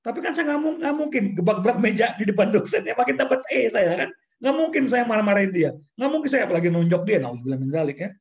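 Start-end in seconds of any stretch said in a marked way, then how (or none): 0.1-7.2 higher and louder, barely holding back rage; worked-up indignation. Retold or imitated. Recounting to the host why he couldn't hit the lecturer, heated indignant voice. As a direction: spill out the frustration, voice climbing. Retelling suppressed fury at lecturer.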